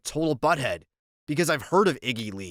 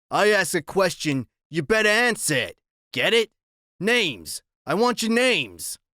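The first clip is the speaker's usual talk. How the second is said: louder, higher menacing biker; biker threat; 6 semitones higher, 3 dB louder than usual. A narrator's reading.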